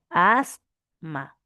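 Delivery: AS, held, pause, then MA; split into syllables. Shown as a demonstration. Teacher showing that two consonants separate, syllable by syllable. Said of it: "¿Cómo sería?".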